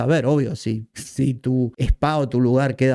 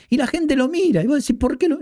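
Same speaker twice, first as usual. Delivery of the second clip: incredulous disbelief; 12 semitones higher than usual. Real, not acted. Disbelief at viewers watching.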